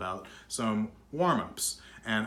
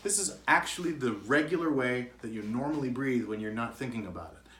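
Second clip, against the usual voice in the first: usual speaking voice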